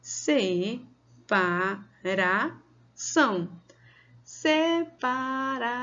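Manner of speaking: "se", "pa", "ra", "ção" with gaps; slow, syllable by syllable. Shown as a demonstration. Teacher has children count on fingers, syllable by syllable. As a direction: open up each syllable of "separação" separately. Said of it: "abre a boca devagar".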